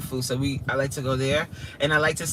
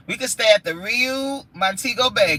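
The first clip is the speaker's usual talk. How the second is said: ordinary delivery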